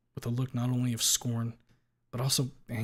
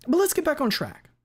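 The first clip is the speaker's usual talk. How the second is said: higher, louder lawyer question; 8 dB louder than usual. Acted. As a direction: ask it like a sharp attorney. Lawyer addresses court.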